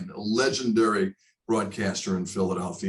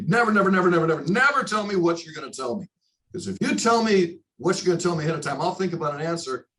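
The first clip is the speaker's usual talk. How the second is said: emphatic retold insistence; 7 semitones higher, faster than usual. Legendary broadcaster warning the young reporter, emphatic and insistent. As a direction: hammer never home like a mentor laying down the law.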